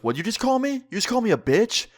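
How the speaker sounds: high-pitched retold outrage; mock indignant challenge. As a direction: imitate someone affronted and spoiling for a fight. Acted indignant challenge before a fight.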